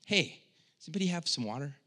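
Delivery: light, breezy, quick offhand question; casual ask. Retold or imitated. Preacher mocking a casual thirst, breezy quick voice. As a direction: toss it off like asking a room casually. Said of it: "it's not like".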